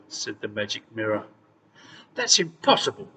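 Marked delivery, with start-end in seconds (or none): 2.1-3.1 angry shriek, raised and loud; screaming. Acted. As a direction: scream it in disbelief. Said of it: "screamed the queen".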